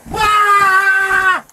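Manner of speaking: long, loud high yell; drawn-out angry holler; raging scream. Real, not acted. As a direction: scream out in fury. Detainee screams in rage.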